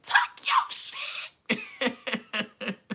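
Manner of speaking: high, very slow hype cheer; drawn-out hype cheer. Retold or imitated. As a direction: stretch it out to hype him up. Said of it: "I was literally like".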